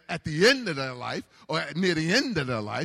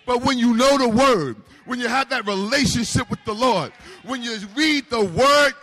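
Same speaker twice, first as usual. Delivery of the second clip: loud, higher, building preacher's cry; full force preaching; 5 semitones higher, 6 dB louder than usual. Real, not acted. Pastor rallying the congregation against the devil, loud and high. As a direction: raise the roof with it.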